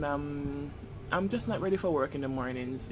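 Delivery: ordinary delivery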